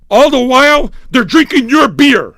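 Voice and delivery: loud, high exasperated yell; yelling rant. Real, not acted. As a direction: rant it out at full voice. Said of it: "I love yelling".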